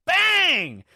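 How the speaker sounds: huge high shouted call; announcer yell. Retold or imitated. Broadcaster imitated, shouting a three. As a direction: bark it like a hyped announcer. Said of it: "he's always like".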